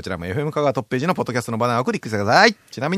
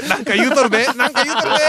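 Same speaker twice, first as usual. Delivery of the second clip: louder teasing repeat; 9 semitones higher, 4 dB louder than usual. Real, not acted. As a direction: call it out to tease him.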